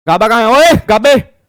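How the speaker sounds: loud angry yell calling out Gaba; shouting for Gaba. Retold or imitated. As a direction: bellow the name across the shop. (गुस्से में ज़ोर से चिल्लाकर "गाबा कहाँ है")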